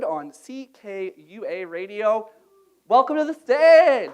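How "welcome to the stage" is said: loud, high host's call; stage welcome. Real, not acted. Host bringing the next reader to the stage, calling out. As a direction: call the next act up, voice lifted.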